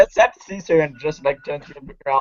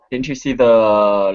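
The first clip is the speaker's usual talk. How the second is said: ordinary delivery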